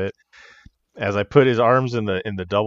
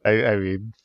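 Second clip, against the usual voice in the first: ordinary talk, nothing marked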